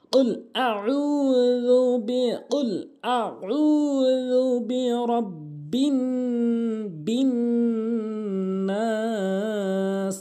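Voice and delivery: slow, high, drawn-out tilawat; Quran recitation. Shown as a demonstration. Teacher models the verse in slow chanted tilawat. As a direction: chant the verse with long held vowels.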